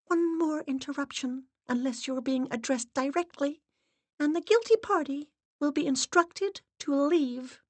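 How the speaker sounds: high, measured warning; stern assistant. Acted. Assistant threatens to expel interrupters. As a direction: warn them coldly.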